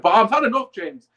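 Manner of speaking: loud burst of frustration; suddenly loud, fed up; fed-up shout. Real, not acted. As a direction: let it out, had enough. Fed-up outburst.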